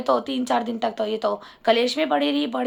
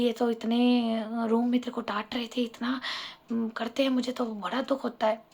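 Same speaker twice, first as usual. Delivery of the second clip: hushed sympathy; 3 semitones lower, 6 dB quieter than usual. Retold or imitated. Aunt whispering sympathy.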